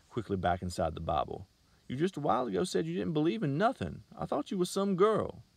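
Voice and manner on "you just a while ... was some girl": put-on much higher, taunting country boy; mocking taunt. A narrator's reading. Salesman taunting the girl, much higher. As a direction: throw her words back at her.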